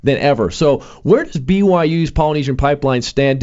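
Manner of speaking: usual speaking voice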